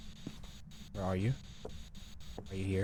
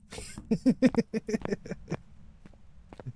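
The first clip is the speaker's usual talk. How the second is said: high, drawn-out giggling laugh; real laughing fit; 13 semitones higher than usual. Real, not acted. Player reacting to the banshee's scream, high helpless laugh. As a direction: burst out laughing, high-pitched.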